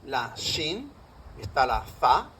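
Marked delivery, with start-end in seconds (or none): 0.3-0.9 "shin" begun with a sh sound; Arabic sh sound. Shown as a demonstration. Lecturer listing Arabic sibilants to students, sh sound. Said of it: "cinco sonidos que se parecen a la S". 2.0-2.3 "za" with a th-like sound; Arabic letter name. Shown as a demonstration. Lecturer listing Arabic sibilants to students, th-like za. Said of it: "cinco sonidos que se parecen a la S".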